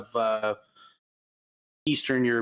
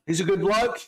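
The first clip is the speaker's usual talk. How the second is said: high, put-on Aussie voice; exaggerated Australian vowels, raised pitch; Australian accent; 9 semitones higher than usual. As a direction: play a hearty Australian, pitched up. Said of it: "maybe just do the Australian".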